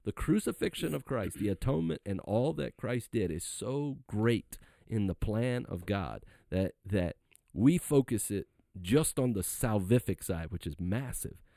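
emphatic, swelling sermon voice; impassioned preaching. Real, not acted. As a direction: press the point as from a pulpit. Preaches on the atonement.

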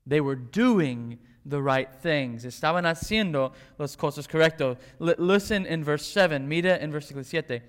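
ordinary talk

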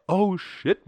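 high, loud startled exclamation; sudden alarm. Real, not acted. Host spotting a dying battery, real startled outburst. Notices battery running low mid-sentence.

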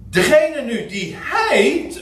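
slow, with "hij" lifted high and held; stress on hij. Shown as a demonstration. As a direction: lean hard on "hij" and take it slowly. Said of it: "als je de klemtoon goed legt". (nadruk op "hij", hoog en langzaam)

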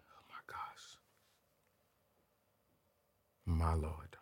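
breathless whisper of awe; whispered swoon. Real, not acted. Remembering the beautiful girl, awed whisper. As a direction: whisper it like she just walked by.

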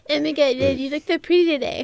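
lightly voiced Cartman impression; a hint of cartoon Cartman, gushing; Richard. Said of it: "I always give Richard a Cartman voice".

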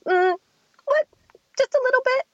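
put-on high coy child. Daughter admitting she peed a bit, in a high coy voice. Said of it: "and goes".

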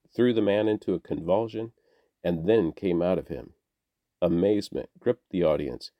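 ordinary delivery

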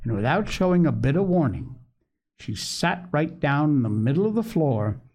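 usual speaking voice

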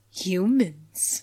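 slow, dramatic one-word reveal. Retold or imitated. Podcaster reenacting Zach's big reveal about the bite marks, in a slow dramatic voice. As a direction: turn slowly and drop it like a movie line. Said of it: "Zach slowly turns, and then he goes".

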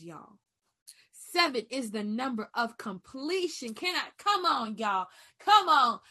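loud, high preaching cry that rises on each come on; full-force sermon, pushing each phrase out loud and high. Real, not acted. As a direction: preach it like a revival pulpit.

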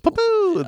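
high popping mouth noise; party popper sound. Pretend. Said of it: "Let's set off the poppers".

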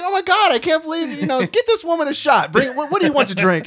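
loud, high-pitched amazed exclaiming; retold comic's excited disbelief; astonished outburst. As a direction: exclaim it to the whole room, floored. Comedian astonished, orders her a drink.

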